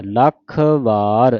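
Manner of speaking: ਲੱਖ held tight, then separate ਵਾਰ; firm full pronunciation. Shown as a demonstration. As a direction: finish ਲੱਖ fully before a long ਵਾਰ. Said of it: "ਲੱਖ ਪੂਰਾ ਕੱਸ ਕੇ ਬੋਲ". (ਲੱਖ ਕੱਸ ਕੇ, ਫਿਰ ਵਾਰ)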